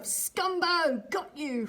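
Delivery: yell. A narrator's reading. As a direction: call out triumphantly across the wood. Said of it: "a yell of".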